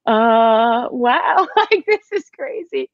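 high-pitched, mock-awed reaction; stunned-fan quote, lifted high; retold amazement. Quoting own amazed reaction.

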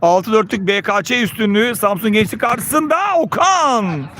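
usual speaking voice

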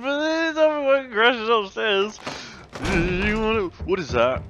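high, loud startled shriek; startled yelp. Real, not acted. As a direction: jumps in fright and yelps. Startled by crash upstairs.

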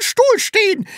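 usual speaking voice

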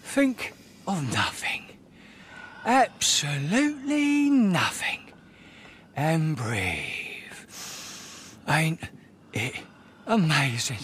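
soft, slow, drawn-out musing. Acted. Club boss alone savouring the moment, softly musing. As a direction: breathe the words out with long pauses.

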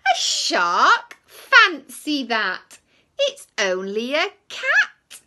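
high, loud and slow; loud surprised reveal. Acted. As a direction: sing out the twist. Relieved reveal in the story.

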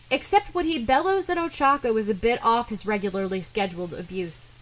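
plain reading voice

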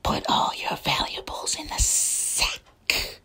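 slow whispered stick-up threat; menacing whispered villain voice. Acted. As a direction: whisper it like a sneaky snake robber. Serpent robber's stick-up.

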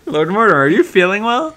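high, loud teasing question; mock concern. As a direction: ask him if he's okay, teasing. Mocking concern at glitching character.

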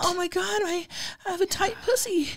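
high, flustered falsetto; high put-on voice of surprise; Greg. Character in comic love scene, high flustered voice.

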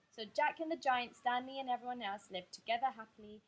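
ordinary delivery

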